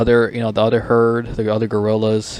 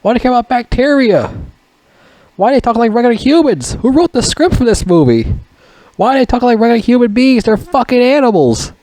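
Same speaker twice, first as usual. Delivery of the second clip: incredulous rant; 12 semitones higher than usual. Retold or imitated. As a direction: rant in disbelief at the movie's script. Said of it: "I'm like".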